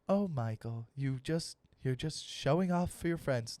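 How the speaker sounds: soft, dismissive British mum; bad British accent. British mother chiding her show-off son, soft accent. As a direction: gently chide in mock British. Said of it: "I don't do a good British accent".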